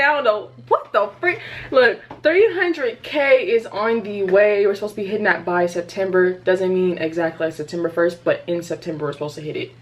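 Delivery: usual speaking voice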